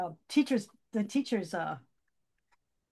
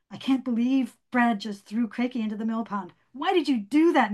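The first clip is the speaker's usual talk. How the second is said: rising angry yell at Brad; 3 semitones higher, 6 dB louder than usual. A narrator's reading. Miriam yelling at Brad for throwing Crakey. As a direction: let the anger climb to a shout. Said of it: "Miriam yelled".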